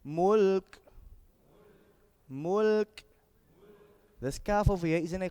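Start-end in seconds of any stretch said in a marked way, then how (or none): 0.0-3.0 held word with released final K; measured tajweed drill; Quran recitation. Shown as a demonstration. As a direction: chant it long, then release the K.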